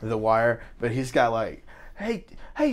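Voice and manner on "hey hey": imitating Idris Elba's thick British accent. Imitating actor's thick British accent on the show. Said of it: "super British".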